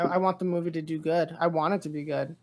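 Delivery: ordinary talk, nothing marked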